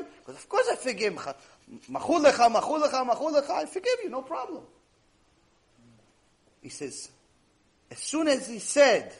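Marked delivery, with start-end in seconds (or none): 0.4-4.6 high, laughing imitation; acted eager forgiving. Student forgiving his neighbour three times, high and laughingly imitated. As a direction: wave the debt away in a high, chuckling voice.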